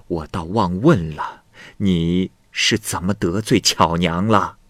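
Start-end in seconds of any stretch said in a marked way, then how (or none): none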